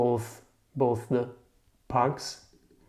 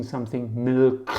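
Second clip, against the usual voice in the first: ordinary delivery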